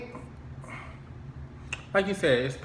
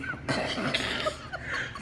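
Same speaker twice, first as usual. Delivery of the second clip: amused laugh; 5 dB quieter than usual. Real, not acted. Joking about camera trick.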